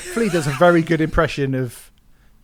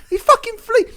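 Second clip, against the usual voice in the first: mock outrage; 4 dB louder than usual. Mocking rant at [name].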